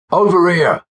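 high call across a crowd; narrator's high-pitched yell. A narrator's reading. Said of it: "a voice yelled".

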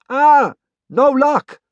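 high, slow, thin character voice; thin, whistly voice. Acted. Tomas commiserates over the comm, thin and whistly. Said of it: "his voice sounding thin and whistly".

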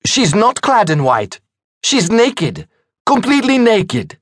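put-on high voice with a slight French accent; hint of French accent. A narrator's reading. Gentleman correcting the hostess's tale, high with a hint of French. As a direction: interject keenly in lightly French-accented English. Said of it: "had an accent that hinted at French roots".

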